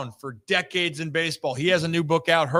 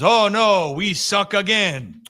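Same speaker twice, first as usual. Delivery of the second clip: a louder mock wail on "Oh no", then a slow drag through "we suck again"; movie quote; 3 semitones higher, 4 dB louder, slower than usual. Host quizzing his cohost with a movie line, mock-moaning it. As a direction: ham up a defeated team's groan. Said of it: "what quote from an Adam Sandler movie goes".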